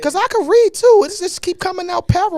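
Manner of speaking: half-laughing frustration at stumbling; exasperated. Real, not acted. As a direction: voice jumps high and loud, flustered. Frustrated at own flubbing.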